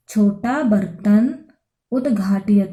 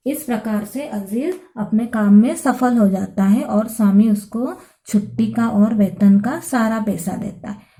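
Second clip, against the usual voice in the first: ordinary delivery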